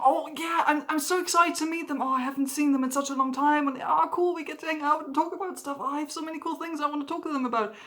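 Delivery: high, fast gushing run-on; giddy excitement. Acted. Someone looking forward to seeing friends, giddy and excited.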